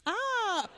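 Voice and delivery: sudden high-pitched ah; surprised high ah. Real, not acted. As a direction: gasp out a high ah. Host reacts to revelation.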